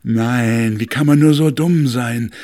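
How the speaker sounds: loud, scornful wind voice; mocking north wind. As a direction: scoff at the stove loudly. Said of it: "sagte er zu dem Ofen".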